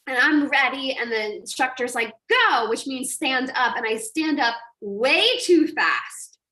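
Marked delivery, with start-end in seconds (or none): 2.3-2.7 called-out command. Retold or imitated. Instructor calling surfer to stand.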